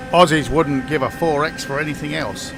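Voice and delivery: half-hearted mock Australian accent; pathetic Australian accent. Joking to a mate about Aussie beer in a poor Australian accent. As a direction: have a bad go at an Aussie accent. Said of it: "That's my pathetic attempt at a, um, Australian accent".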